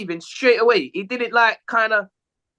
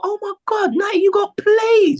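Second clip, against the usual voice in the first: mock wail; 13 semitones higher, 4 dB louder than usual. Retelling his own reaction.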